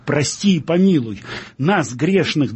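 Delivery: ordinary delivery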